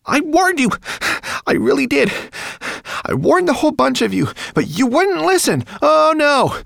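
very high, loud ranting rat; frantic rat. A narrator's reading. Rat scuttling before dragon, frantic reproach. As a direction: scold them in a high panicky outburst. Rat figure rants in alarm.

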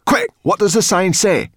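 high, loud demand; urgent cry. A narrator's reading. Sorrel hurries Ben to read the sign, urgent cry. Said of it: "cried Sorrel".

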